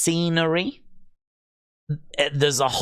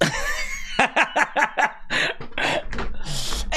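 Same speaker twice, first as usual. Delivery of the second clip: long, high wheezy laugh; amused laughter; 10 semitones higher than usual. Real, not acted. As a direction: crack up in a high, breathless run. Amused at friend regretting suggestion.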